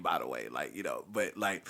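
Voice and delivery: normal talking voice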